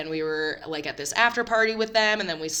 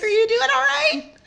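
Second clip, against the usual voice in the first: high, loud falsetto question; exaggerated high worried voice; mock concern; 6 dB louder than usual. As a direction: ask in a high sing-song.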